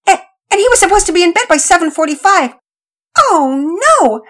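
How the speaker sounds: fast, high rising panic; panicky. A narrator's reading. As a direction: blurt it out in alarm. Boy realizes he is late.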